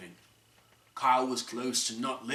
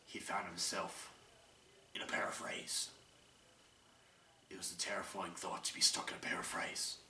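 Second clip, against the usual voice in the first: hushed whisper of a terrifying thought; 5 semitones lower, 10 dB quieter than usual. A narrator's reading. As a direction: breathe it out, scared and secret. Whispered narration of dread.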